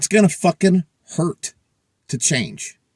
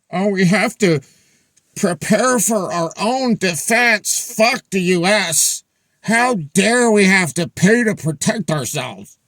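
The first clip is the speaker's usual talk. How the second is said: slow, drawn-out mock outrage; mock indignant Europeans; 4 semitones higher, slower than usual. Vlogger mimicking Europeans upset over paying for defense, mock indignant. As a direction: play put-out Europeans, hammed-up.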